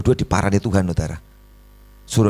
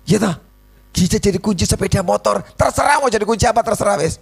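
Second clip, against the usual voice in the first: excited preaching; 12 semitones higher than usual. Acted. Preacher excites the congregation about any key, high and eager. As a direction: pile the keys up with rising glee.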